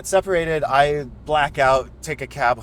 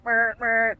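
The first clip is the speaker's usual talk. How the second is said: mock horn honk; 10 semitones higher than usual. Imitating horn honks.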